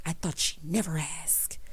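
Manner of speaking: slow, hushed mock whisper. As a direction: imitate a smug singer whispering slowly. Voicing singer eager to perform.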